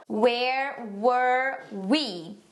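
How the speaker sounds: very slow, separated words; pronunciation practice. Shown as a demonstration. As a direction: stretch out each word for learners to copy. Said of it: "Pronúncia tem que praticar bastante". (pronúncia bem lenta e separada)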